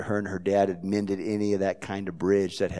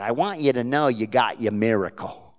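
put-on Jersey accent; 4 semitones higher, 6 dB louder than usual. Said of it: "in her broken Jersey accent".

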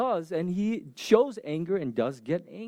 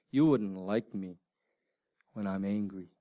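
lekko odegrany filmowy cytat, nisko i z pauzą; a low, measured movie-line delivery, lightly acted, pausing midway; Doctor Banner; 13 semitones lower, 5 dB quieter than usual. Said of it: "what does he say? What's the famous line?".

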